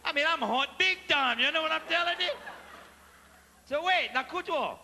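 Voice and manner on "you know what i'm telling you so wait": ordinary talk, nothing marked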